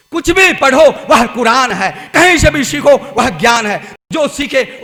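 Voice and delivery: loud, driving sermon voice; fervent preaching. Acted. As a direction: preach it out to the crowd. Preacher exhorts loudly.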